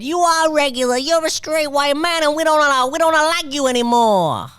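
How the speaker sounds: high mocking cashier voice; mock Dunkin' worker. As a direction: squeal it out like a sassy cashier.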